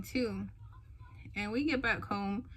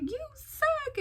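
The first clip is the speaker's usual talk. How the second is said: retold tearful protest. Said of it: "And I just start crying".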